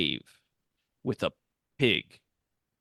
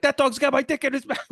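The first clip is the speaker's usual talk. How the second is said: panicked yell; 13 semitones higher, 3 dB louder than usual. Retold or imitated. Retelling dream, chasing dog.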